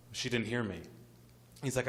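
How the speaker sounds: usual speaking voice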